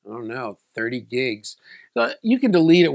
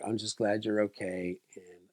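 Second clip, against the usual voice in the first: reassuring dad; 6 semitones lower, 11 dB quieter than usual. Retold or imitated. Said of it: "He's like".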